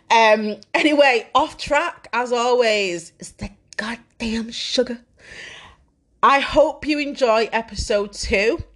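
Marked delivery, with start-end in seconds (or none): 3.2-5.0 whisper. Real, not acted. Joking aside about cake.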